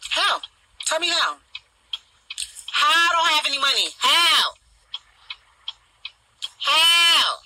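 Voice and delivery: rising indignant yell; building shout. Real, not acted. Escalating challenge to commenter.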